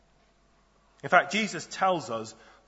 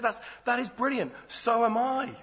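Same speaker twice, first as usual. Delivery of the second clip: higher, gushing stranger voice; eager passerby; 9 semitones higher than usual. Acted. Passerby on a bridge cheerfully agreeing, higher eager voice. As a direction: sound delighted to agree. Joke passerby answers each reply.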